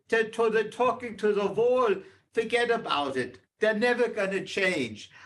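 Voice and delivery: put-on a touch of the weary old soldier; father's words. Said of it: "the third thing he said was".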